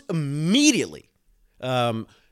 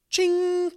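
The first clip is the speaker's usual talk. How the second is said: high, bright idea chime; high ding sound; 5 dB louder than usual. Acted. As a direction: sing a quick light-bulb ding.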